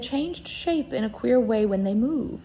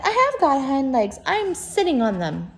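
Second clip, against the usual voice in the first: louder, higher insisting; indignant protest; 6 semitones higher, 6 dB louder than usual. Acted. Little rabbit insisting to the wild rabbit, loud and high. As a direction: defend himself hotly. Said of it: "cried the little rabbit".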